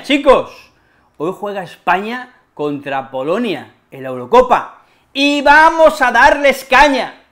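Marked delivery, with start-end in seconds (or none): 5.1-7.2 fired up. Acted. Fired up about the match.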